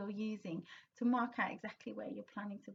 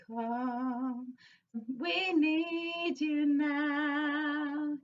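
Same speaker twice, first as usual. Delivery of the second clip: sung with weight on need, fresh, outpouring; stress on key words; 4 semitones higher, 5 dB louder, much slower than usual. Shown as a demonstration. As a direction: lean on need, fresh and outpouring as you sing. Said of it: "put a little bit of weight on those words".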